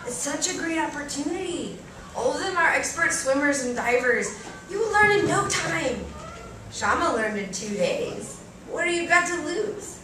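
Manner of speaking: a hint of an eager aunt talking her into it; coaxing aunt. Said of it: "My aunt prodded me again".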